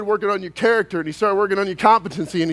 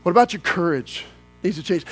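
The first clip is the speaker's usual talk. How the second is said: lower, softer, reflective; quiet counsel; 6 semitones lower, 5 dB quieter than usual. Acted. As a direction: draw them in quietly.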